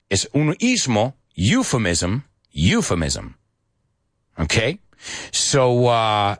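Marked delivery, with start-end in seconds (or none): 1.3-3.4 euphemism as YOO-fuh-miz-um, clearly modelled; model pronunciation. Shown as a demonstration. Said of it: "say it with me".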